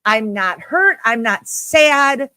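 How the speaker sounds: ordinary talk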